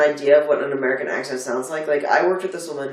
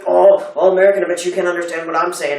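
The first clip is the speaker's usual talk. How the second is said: retold Glaswegian taxi man, loud opening, then fast; Glaswegian accent; 3 semitones higher, 7 dB louder than usual. Taxi man teasing an American, lightly imitated Glaswegian accent. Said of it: "very thick Glaswegian accent".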